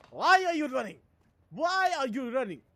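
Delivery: high, loud meme impression with a Nigerian accent. Streamer quoting a meme for fun, loud Nigerian accent. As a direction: demand it in a mock Nigerian accent. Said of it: "He sounds Nigerian. With that accent".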